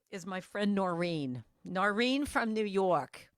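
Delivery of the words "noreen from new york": drawn-out mock New York accent. Speaker introducing her friend, affectionately mocked New York accent. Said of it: "she talks like this".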